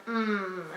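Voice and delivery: "mm" held as a soft hum; letter sound. Shown as a demonstration. Teacher voicing the remembered sound, "mm" as a quiet held hum. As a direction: hum "mm" with no vowel added. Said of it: "hopefully they will remember the sound".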